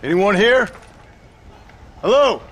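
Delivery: calling out. Acted. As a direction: call out into the empty streets. Calling through empty town.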